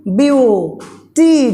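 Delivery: बीयू, then टी, each vowel held; syllable-by-syllable demo. Shown as a demonstration. Teacher recapping vowel sounds, बीयू and टी sounded out. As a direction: separate बीयू and टी as two syllables. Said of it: "टी कहने में ई का pronunciation आ रहा है".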